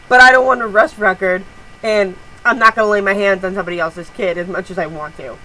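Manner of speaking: normal talking voice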